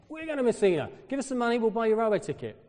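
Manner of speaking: high, loud, excited offer; imitated eager locals. Italians offering to buy his railway ticket, excited high imitation. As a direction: jump right up in pitch, loudly playing keen helpers.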